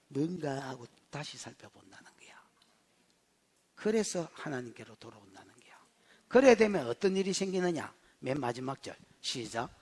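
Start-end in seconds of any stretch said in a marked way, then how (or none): 0.1-5.7 very soft, low, confiding hush; hushed preaching. Real, not acted. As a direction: drop low into a near-whisper. Preacher drops to hush for effect. (낮게 속삭이듯 설교)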